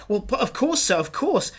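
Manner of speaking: startled. Acted. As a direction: jump as if shot and blurt it out. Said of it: "jumps as if he's been shot".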